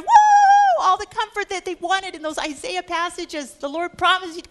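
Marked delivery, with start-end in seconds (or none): 0.0-0.8 crowd whoop. Acted. Crowd cheering the comfort promise.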